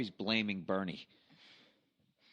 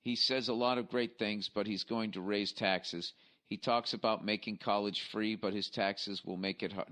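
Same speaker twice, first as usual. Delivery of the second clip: slow, deliberate read-out; reading email aloud; slower than usual. Acted. Host reading a listener's election email aloud, measured read-out. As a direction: read a listener's letter word by word, slowly.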